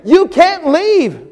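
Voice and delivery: very high, very slow pleading; slow earnest plea. Retold or imitated. Church member pleading with the pastor, very slow high plea. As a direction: stretch it out, begging. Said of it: "he said".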